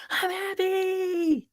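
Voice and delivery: put-on very high, slow, drawn-out happy child voice; imitating child. Father retelling son's reply, high childlike voice. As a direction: stretch it out like a beaming kid. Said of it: "And he goes".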